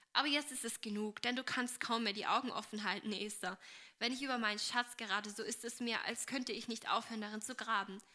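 plain reading voice

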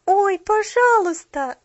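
high, loud, drawn-out plea; imploring. A narrator's reading. Child begging the cashier to come, high imploring cry. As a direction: beg like an eager child. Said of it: "взмолилась Полианна".

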